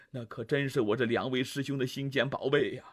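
压低嗓门、声音放轻地劝; 拉到一旁悄悄地说; 低声劝说. Acted. 姜子牙低声劝老婆. (姜子牙拉开老婆，压低声音劝)

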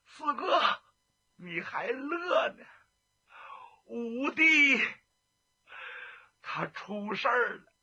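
tearful reproach. Acted. Telling the laughing Fourth Brother that Fifth Brother is lost, tearful. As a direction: plead through tears that this is no time to laugh.

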